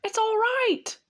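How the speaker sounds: high, excited beaver call; shouting. A narrator's reading. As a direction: call out from outside the cave. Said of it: "he was shouting".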